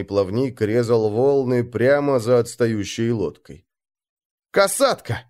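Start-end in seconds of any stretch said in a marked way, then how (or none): 4.5-5.2 gasping cry of alarm; breathless exclaim. A narrator's reading. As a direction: gasp the word out in fright. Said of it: "задыхаясь, воскликнул Мороз".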